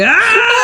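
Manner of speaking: high laughing cry of Ah; a mock yelp at the cats; laughing shriek. Real, not acted. Cats shoving her in morning.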